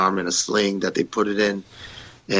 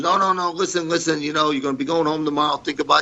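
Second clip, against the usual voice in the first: higher, fast pleading promoter voice; imitating Pat; 7 semitones higher, faster than usual. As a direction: mimic Pat begging him to stay. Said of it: "So he goes".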